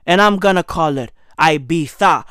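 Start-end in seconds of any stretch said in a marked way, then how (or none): 1.4-2.2 "Ibiza" as eye-BEE-tha, low; lisped TH Ibiza. Shown as a demonstration. Comic following the Catalans, "Ibiza" with TH. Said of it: "I'm gonna pronounce it with a tha".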